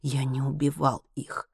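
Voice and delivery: soft, slow, weary denial; dejected. A narrator's reading. Henry denies the killings to his comrades, dejected. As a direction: say it slowly and quietly, downcast. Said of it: "Генри удручённо вздохнул".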